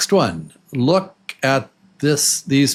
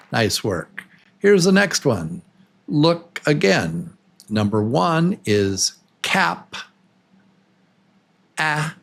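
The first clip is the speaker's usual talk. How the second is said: normal talking voice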